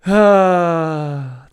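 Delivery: drawn-out, falling "uhhh"; loud exhale sliding slowly down; long loud groan. Real, not acted. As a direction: let out a big sustained groan. Long wordless noise after outburst.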